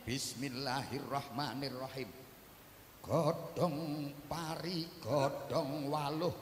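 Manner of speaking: slow, chanted mantra over a toothache; Javanese healing incantation. Shown as a demonstration. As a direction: recite it slowly like a village kiai's spell. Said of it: "Disuwuk kaya ngene".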